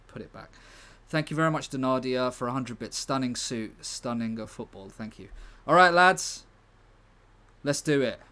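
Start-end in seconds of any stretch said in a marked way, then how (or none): 5.7-8.2 high, loud call to the lads; rally the team before kickoff; rallying shout. Real, not acted.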